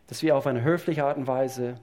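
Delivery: usual speaking voice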